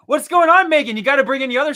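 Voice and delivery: high, loud hello; excited greeting. Real, not acted. Host greeting Megan, loud and high. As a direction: call out a big hello.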